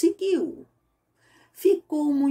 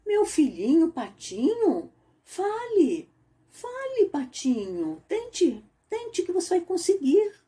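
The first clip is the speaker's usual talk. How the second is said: encouraging mother duck; 8 semitones higher than usual. Acted.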